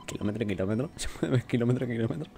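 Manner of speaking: soft, laughing question; amused disbelief. Real, not acted. Player puzzled by the odd line he just read, laughing. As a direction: repeat it quietly through giggles.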